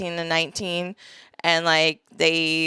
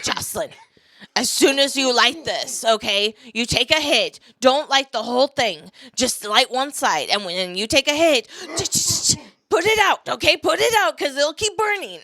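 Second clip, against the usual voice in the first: dad voice; 4 semitones higher than usual. Retold or imitated. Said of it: "being like".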